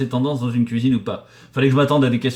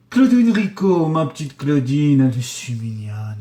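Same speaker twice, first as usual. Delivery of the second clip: fond call-out; 3 semitones higher, 5 dB louder, slower than usual. Real, not acted. Fondly greeting a viewer by name.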